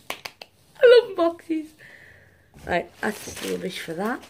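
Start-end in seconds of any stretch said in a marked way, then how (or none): 0.1-1.7 gushing. Real, not acted. Gushing over boxes.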